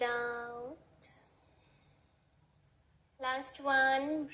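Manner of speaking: soft, even breathing instructions; guided breathing cues. Acted. Yoga teacher guiding students through breaths, soft and even. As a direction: pace the class through each breath calmly.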